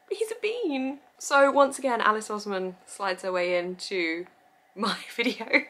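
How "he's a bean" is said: cooing. Real, not acted. Gushing over cute pug.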